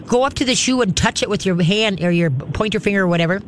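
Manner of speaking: normal talking voice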